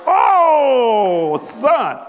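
loud, high, delighted "oh, son"; loud delighted aside; loud exclamation. Real, not acted. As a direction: belt it out in amused delight. Exclamation after laughing.

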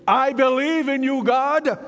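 rising declaration of trust in God. Pretend. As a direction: call it out like a growing voice of faith. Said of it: "your voice is growing louder and saying".

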